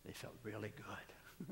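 soft confiding whisper; hushed comic aside. Real, not acted. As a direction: let the congregation in on a little secret. Joking aside about ugly shoes.

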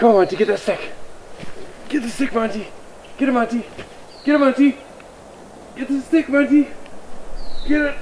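high, coaxing calls to a dog; excited urging. Real, not acted. Owner cheering dog toward the stick, excited. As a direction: coax the dog in a high, eager voice.

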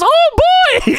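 very high puppet squeal; Elmo. Acted. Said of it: "Elmo walks into the room and says".

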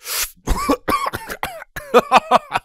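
choking on a drink, loud coughing fit; spluttering cough. A narrator's reading. Vladimir chokes on his drink, loud spluttering cough. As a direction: sputter as the drink goes down wrong. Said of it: "Vladimir spluttered as he inhaled some of his drink".

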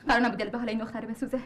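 ordinary delivery

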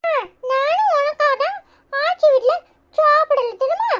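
put-on high-pitched little kid brother voice; little brother, eager. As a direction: chatter up high like a small boy telling his big brother. (உச்ச குரலில் பேசும் குட்டித் தம்பி)

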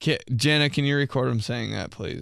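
ordinary delivery

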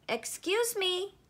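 put-on high-pitched child voice; boy calling out. A narrator's reading. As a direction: pipe up like a boy seeking attention. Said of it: "a boy shouted".

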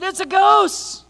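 high, slow frightened cry of ghost; terrified cry. A narrator's reading. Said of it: "they were terrified and said".

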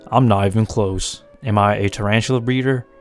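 ordinary delivery

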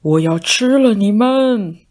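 loud, slow monster threat; scariest voice. Acted. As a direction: roar it to scare the mice. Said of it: "用最最可怕的声音叫了起来". (大肥猫用最可怕的声音大叫)

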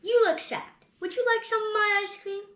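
cheerful Piggy; Piggy's voice. Acted. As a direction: offer it brightly as the best friend. Piggy offers to share.

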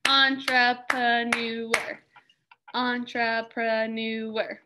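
"Entrepreneur" said very slowly, en-tre-pre-neur, syllable by syllable; syllables clapped out. Shown as a demonstration. Teacher clapping the syllables together with students, very slow. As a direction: drag "Entrepreneur" out, one beat per syllable. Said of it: "Let's do it together".

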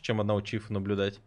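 ordinary delivery

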